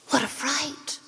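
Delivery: breathless scared whisper; frightened whisper. A narrator's reading. Witches staring at the swelling dough, frightened whisper. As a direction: whisper it in sudden fear.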